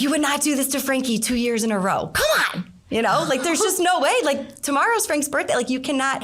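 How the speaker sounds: mock yell at God from the gurney, pitched high; retold yelling. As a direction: plead and protest upward, pitch climbing high. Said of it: "I started yelling at him".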